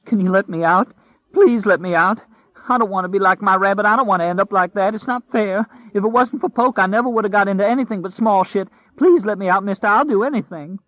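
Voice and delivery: high, loud, rushed begging; sobbing plea. A narrator's reading. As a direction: beg desperately, words tumbling out. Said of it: "Lloyd sobbed".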